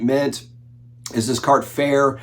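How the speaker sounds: ordinary talk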